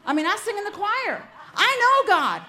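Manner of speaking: rising high and loud, half laughing; mock indignant, I know this; defensive retort. Young wife answering mother-in-law, mock indignant retort. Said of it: "I'd look right back at her and I'd say".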